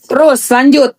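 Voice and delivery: ordinary talk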